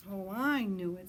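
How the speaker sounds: proud little owl, leaning on "I"; eager owlet. A narrator's reading. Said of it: "said Sarah".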